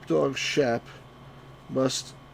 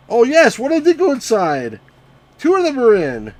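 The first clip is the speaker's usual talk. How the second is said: much higher, much louder victory shout; triumphant cheer; 12 semitones higher, 13 dB louder than usual. Real, not acted. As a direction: cheer as the sheep go in. Sheep finally enter barn.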